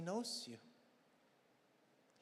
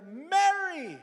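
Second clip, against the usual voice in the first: Jesus calling out Mary, high and very loud; 13 semitones higher, 15 dB louder than usual. Shown as a demonstration. Preacher acting out Jesus calling Mary, loud and high. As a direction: call her name out at full voice. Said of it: "Pero may exclamation point. So it must have been loud".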